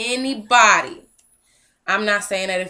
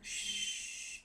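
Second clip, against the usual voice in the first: hush; 19 dB quieter than usual. Real, not acted. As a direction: hush her quietly. Hushing the child.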